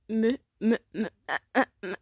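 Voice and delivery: stuck repeating one syllable; real stammer, breaking up into fragments. Real, not acted. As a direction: stumble and stutter on the word. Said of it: "Je bégaye maintenant".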